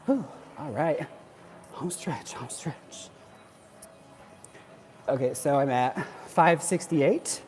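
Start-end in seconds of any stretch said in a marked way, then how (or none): 2.3-3.1 whispered under the breath. Real, not acted. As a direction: whisper it to yourself. Repeats phrase, whispered second time.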